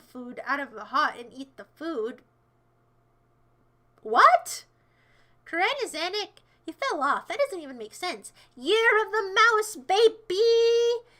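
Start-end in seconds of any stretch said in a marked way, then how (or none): 4.0-4.6 loud, high, rising yelp of disbelief; shocked. Real, not acted. Streamer reacting to nonsense from chat, shocked. 8.6-11.1 high, loud, slow drawn-out cheer; hype shout. Pretend. Streamer celebrating the mouse year, hype shout.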